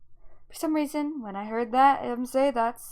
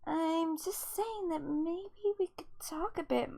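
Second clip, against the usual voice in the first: slow, hushed, hesitant plea; timid near-whisper; 5 semitones higher, 8 dB quieter than usual. Acted. Daughter asking dad to talk, timid near-whisper. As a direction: tread carefully, high and barely voiced.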